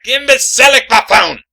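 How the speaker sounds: loud shouted buffoon; blurted insult. Acted. Caleb's fake apology bursts into a shouted insult. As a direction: let the insult explode.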